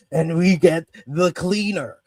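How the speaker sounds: ordinary delivery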